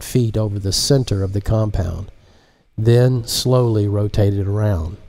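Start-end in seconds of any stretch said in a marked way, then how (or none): none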